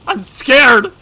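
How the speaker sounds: loud, high cry of fear; terrified cry. Acted. Yoshimura near tears at the thunder, terrified. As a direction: cry out in rising panic. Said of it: "on the verge of tears".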